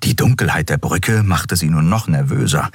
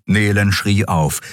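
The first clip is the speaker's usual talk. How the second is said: usual speaking voice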